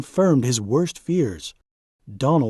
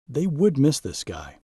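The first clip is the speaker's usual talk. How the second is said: ordinary delivery, nothing marked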